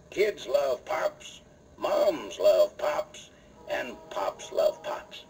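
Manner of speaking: very slow, measured slogan; reciting a pet saying. Acted. Cowboy host sharing his pet saying with young listeners, slowly recited. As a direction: draw out each phrase, pausing between. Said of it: "listen to this little saying I always use".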